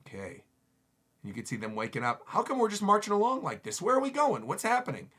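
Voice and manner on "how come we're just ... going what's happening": put-on high-pitched puzzled kid voice; confused child. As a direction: ask as a bewildered child waking up.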